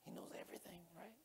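very soft, near-whispered reminder; murmured, barely audible reassurance; hushed aside. Real, not acted. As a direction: let it drop almost to a whisper.

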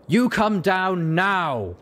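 high, very slow bellowed command; drawn-out bark through a megaphone; barked order. Acted. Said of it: "she barked".

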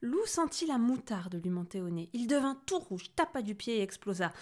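usual speaking voice

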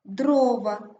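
Дрова with a loud first syllable, ДРО-ва; stress on О. Shown as a demonstration. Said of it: "наголос падає на О". (Дрова з сильним наголосом на ДРО)

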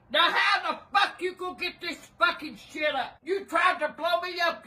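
ordinary delivery

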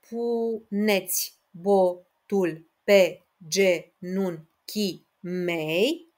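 very slow, one syllable at a time; split into syllables. Shown as a demonstration. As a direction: count each syllable out on your fingers. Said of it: "haideți să despărțim împreună".

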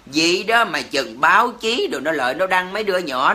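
usual speaking voice

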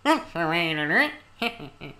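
lightly voiced Mr Punch, slow and squawky; a hint of puppet Punch, drawn out; Punch and Judy voice. Voicing an overheard game character, light Punch squawk. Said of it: "Fucking Punch and Judy".

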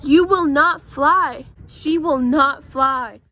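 slow, firm, deliberate refusal; Elephant, stern. Acted. Elephant firmly tells Piggy he won't fly, slow and stern. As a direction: lay down the law slowly like a big stern elephant.

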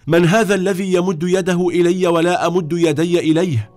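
high-pitched, indignant outburst; angry cry. A narrator's reading. Mother demands who offers her a hand, angry cry. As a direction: exclaim angrily, pitch held high throughout. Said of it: "صرخت أمها في غضب".

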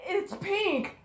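loud, high shocked question; shocked outburst. Acted. Hero shocked to learn the sloth has a daughter. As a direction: blurt it out in disbelief.

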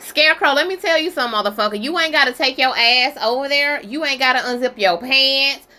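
ordinary talk, nothing marked

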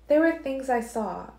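put-on Alice cautioning Edward about the girl's blood; Alice, warning. A narrator's reading. Alice voiced.

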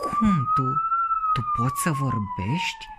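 very high amazed squeak; astonished. A narrator's reading. Boy finds the cauliflower can talk, astonished. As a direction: gasp it out in disbelief.

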